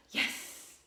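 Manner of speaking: loud, breath-heavy whispered yes; excited yes. Shown as a demonstration. Showing the tone of excitement, thrilled. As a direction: hiss it out with excitement. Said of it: "There's a tone of voice".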